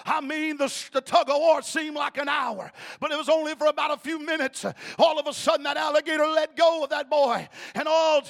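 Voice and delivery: high, loud preaching climax; full-force preaching. Acted. Preacher shouting the rescue to the congregation. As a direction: drive the story home at full force.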